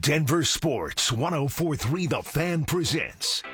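ordinary talk, nothing marked

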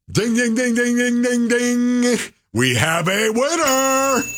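high, bright ding-ding winner call; mock quiz-show bell and winner call; game-show host. Announcing a winner, game-show style.

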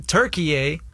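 "Turkey" read with an extra final "eh"; puzzled "Turkey" said as tur-kee-EH; Turkey with added eh. Retold or imitated. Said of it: "then I see the abbreviations".

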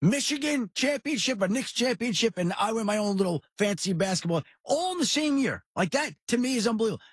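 high-pitched, excited run through the wins; real elation. Real, not acted. Host marvels at all three titles in one year, elated.

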